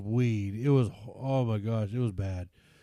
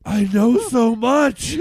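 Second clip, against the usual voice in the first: higher, louder, slow smug drawl; mock smug; 10 semitones higher, 10 dB louder, much slower than usual. Son mocking his own pride to friends, slow and smug. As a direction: stretch it out, hammed-up pride. Teen's sarcastic inner thought.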